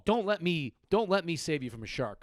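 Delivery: usual speaking voice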